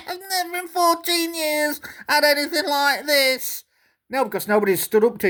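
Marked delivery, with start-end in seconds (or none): 0.1-3.6 high, put-upon whine; mock his hurt innocence; pitched-up sulky complaint; whiny Gary impression. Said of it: "he's bloody whinging".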